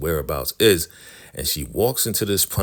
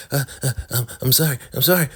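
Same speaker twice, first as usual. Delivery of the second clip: high, stammering, panicked sorry; flustered apology; 8 semitones higher, much faster than usual. Retold or imitated. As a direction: blurt the apology in a rattled high voice. Laura caught in wrong trailer.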